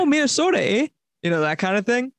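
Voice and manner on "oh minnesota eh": put-on high, lilting Minnesota accent. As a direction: put on a northern Midwest accent, pitched up. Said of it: "North Midwest accent".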